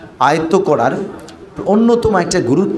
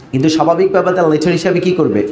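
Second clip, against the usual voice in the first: usual speaking voice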